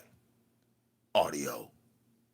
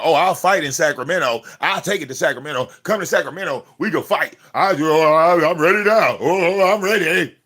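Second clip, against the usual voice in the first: higher and louder mock bravado; mocking imitation of a rival's tough talk, pitched up and loud; 7 semitones higher, 9 dB louder than usual. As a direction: ham up a small-town boy's empty threats. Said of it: "A little Placerville boy talk about".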